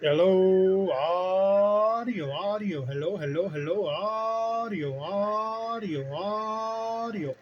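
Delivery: hollering a radio mic check. Shown as a demonstration. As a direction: belt the test words right into the mic. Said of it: "with my mouth right into it hollering".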